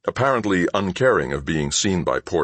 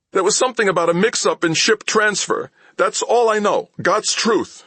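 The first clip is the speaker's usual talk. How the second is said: high, louder desperate plea; pleading thief; 4 dB louder than usual. A narrator's reading. Thief insisting to Mal, high pleading voice. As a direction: swear it's the truth.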